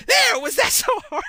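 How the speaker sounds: put-on high, loud smug old lady shout. Old lady gloating at the clerk, high and loud. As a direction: crow it up high, pleased with herself. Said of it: "And the old lady's like".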